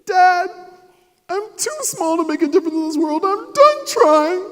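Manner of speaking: put-on high, loud teenage whine; whiny teen. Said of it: "I was like".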